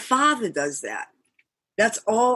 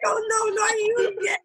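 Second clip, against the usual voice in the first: high, laughing mock dismay; laughing quote; 14 semitones higher than usual. Imitating Frank seeing her again, laughing. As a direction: giggle through Frank's groan. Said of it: "He's like".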